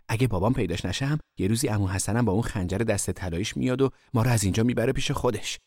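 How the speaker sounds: plain reading voice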